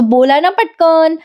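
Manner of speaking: high, drawn-out impatient plea; eager urging. Retold or imitated. Husband dying to hear about the exam, eager urging. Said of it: "Parth असा आतुरतेने म्हणत".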